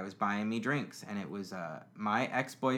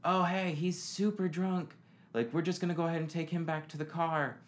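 friends' mock voice; 7 semitones higher than usual. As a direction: play the sober friends taking charge. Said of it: "They were like".

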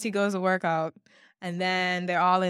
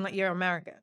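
ordinary talk, nothing marked

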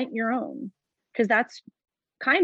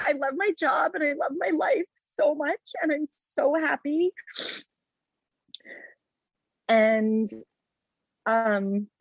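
higher, choked-up voice on the edge of tears; real emotion breaking through the words; getting emotional; 4 semitones higher than usual. Real, not acted. Guest tearing up while describing loving her job, emotional voice. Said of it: "I'm getting so emotional about this".

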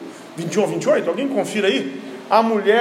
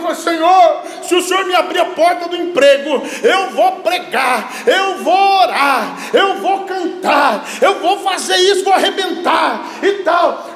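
high, loud impassioned preaching, long drawn shouts; preaching at full force; 9 semitones higher, 4 dB louder than usual. Acted. Pastor to congregation about quitters, shouting fervently. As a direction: preach it from the pulpit with fire.

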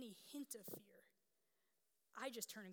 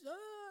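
put-on high, louder child's wail; imitated hysterical wail; 11 semitones higher, 5 dB louder than usual. Seven-year-old collapsed in the hallway, imitated hysterical wail. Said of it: "she's hysterical".

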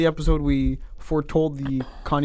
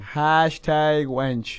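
slow drawn out; much slower than usual. Shown as a demonstration. Drawn out catchphrase.

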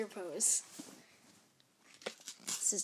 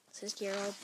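ordinary delivery, nothing marked

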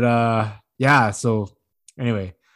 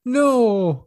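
high, drawn-out mock wail; high-pitched protesting little girl; crying child imitation; 12 semitones higher than usual. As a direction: imitate a kid wailing in refusal. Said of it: "and she's crying".

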